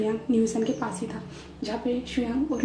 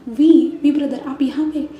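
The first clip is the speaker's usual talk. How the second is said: surprised greeting; 3 semitones higher, 9 dB louder, slower than usual. Acted. New San surprised to see Wei.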